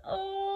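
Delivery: long high-pitched frightened oh; scared "oh". Shown as a demonstration. Storyteller showing children fear, long high "oh". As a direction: pretend to be very scared. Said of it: "show me a very scared face".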